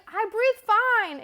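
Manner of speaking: high, loud, drawn-out objection; indignant protest. Retold or imitated. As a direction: insist on it slowly and loudly. Retelling indignant refusal.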